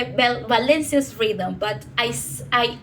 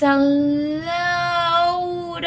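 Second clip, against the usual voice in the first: LOT hugely stretched on a raised pitch; drawn-out emphasis; 7 semitones higher, 4 dB louder than usual. Real, not acted. Speaker stresses how many cifrinos Valencia has, LOT elongated. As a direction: drag out the vowel of "lot", high.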